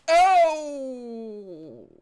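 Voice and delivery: sudden high loud cry of shock. Real, not acted. Viewer shocked by the scene, sudden loud cry. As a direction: yelp as the attack lands. Shocked reaction to a zombie attack.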